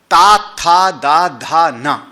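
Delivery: ता, था, दा, धा, ना clearly voiced with tongue to teeth; dental consonants demo. Shown as a demonstration. Teacher demonstrating dental sounds to students, sounding ता था दा धा ना. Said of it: "जब आपकी जीभ दांत से मिलेगी".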